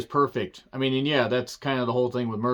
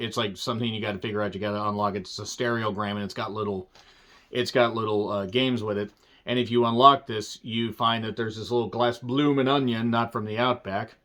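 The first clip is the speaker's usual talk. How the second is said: ordinary delivery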